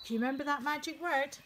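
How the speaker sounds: ordinary delivery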